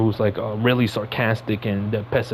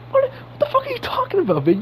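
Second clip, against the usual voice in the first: incredulous outburst. Real, not acted. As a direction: voice cracks way up in disbelief. Exasperated rant at Aunt May.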